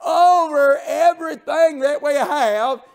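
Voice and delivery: high, loud, tearful preaching cry; preaching at full force. Acted. Preacher to the congregation on God's power, full-force tearful preaching.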